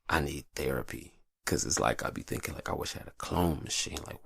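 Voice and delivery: ordinary delivery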